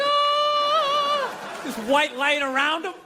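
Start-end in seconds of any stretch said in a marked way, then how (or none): 0.0-1.6 high sung angelic ah, held long; mock heavenly choir. Comedian mocking a preacher's descent: a high angelic choir note. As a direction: sing a high holy "Ah" like a choir. Levitate down from the ceiling.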